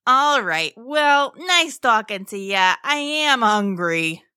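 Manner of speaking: sociable sea creature signing off; lilting, casual monster voice; chatty monster. A narrator's reading. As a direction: wrap up the chat as a friendly mosasaur. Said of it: "the monster responded".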